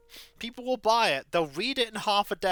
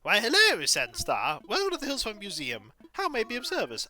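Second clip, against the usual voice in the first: high, hooting owl who startles awake, then greets politely; be a flustered old owl curator, hooting and courteous; fussy owl curator; 8 semitones higher than usual. Acted. Owl curator welcoming Sandstar to the museum, hooting and polite.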